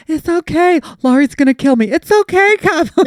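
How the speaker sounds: high, loud mock-panic reassurance; panicked soothing. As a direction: imitate someone frantically calming a dog while terrified. Cousin frantically soothing dog, acted out.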